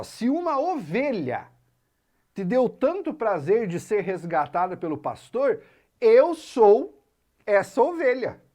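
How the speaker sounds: high, then loud on "eu sou essa ovelha"; fervent lyric self. Retold or imitated. Teacher voicing the poet's lost-sheep plea with high, loud fervour. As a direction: plead it as the lost sheep, rising to a cry. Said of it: "ele fala, ó".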